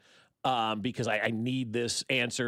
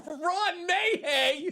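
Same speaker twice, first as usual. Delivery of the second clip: laughing disbelief; much slower than usual. Real, not acted. Laughing disbelief at odd name.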